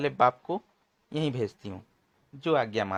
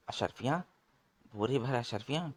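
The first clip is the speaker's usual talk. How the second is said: greedy whisper; 6 dB quieter than usual. Acted. Thugs whisper in greedy surprise.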